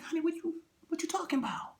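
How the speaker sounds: high, soft wife voice; gentle high bewilderment; puzzled wife. Acted. Wife asks husband what he means, high and soft.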